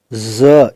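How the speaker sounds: ज as a buzzing z between clenched teeth; z sound demo for students. Shown as a demonstration. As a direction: breathe out through the teeth on ज. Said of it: "इसको इस तरह से बोलेंगे".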